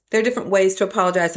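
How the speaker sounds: fast, run-together restatement; rushed repeat. Shown as a demonstration. Preacher repeating her point quickly to the congregation, fast. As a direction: rattle it off fast. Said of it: "repeated line, quicker take".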